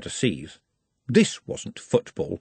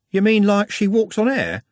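higher, confused question; lightly voiced baffled lad; puzzled Trev; 10 semitones higher, 4 dB louder than usual.

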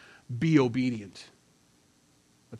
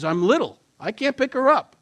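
put-on much higher, louder kid voice; little boy's voice; 10 semitones higher, 7 dB louder than usual. Son explaining to dad why he failed, high kid voice. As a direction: plead like a small boy who can't manage. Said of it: "He goes".

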